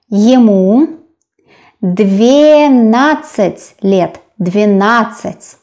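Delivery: "двенадцать" stretched into long, separate syllables; careful, very slow model of "двенадцать"; slow sounding-out. Shown as a demonstration. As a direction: draw out "двенадцать" for a learner. Teacher sounds out new number.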